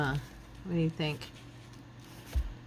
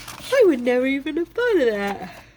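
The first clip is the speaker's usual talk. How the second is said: high, loud amazed gush; 9 semitones higher, 6 dB louder than usual. Retold or imitated. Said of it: "And I'll be like".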